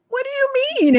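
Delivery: retelling a near-tearful moment in the executive's office, voice shooting up high; on the verge of tears. Retold or imitated. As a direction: sound like your lip is quivering. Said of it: "my bottom lip starts quivering and I'm literally trying not to cry".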